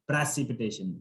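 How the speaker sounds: "precipitation" with an open "pra" start; correct precipitation. Shown as a demonstration. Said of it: "not preci".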